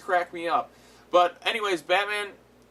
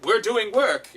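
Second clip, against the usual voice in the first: cheerful shout; 7 semitones higher, 6 dB louder, slower than usual. Retold or imitated. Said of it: "they're like".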